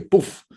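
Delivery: high, loud "poof"; puff sound effect. Shown as a demonstration. Speaker illustrating the mind suddenly expanding, high loud puff.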